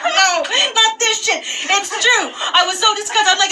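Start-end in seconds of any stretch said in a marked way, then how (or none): 0.1-2.2 a hint of a higher, earnest local guy admitting it. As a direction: mildly imitate the vendor's friend confirming it. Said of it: "He's like".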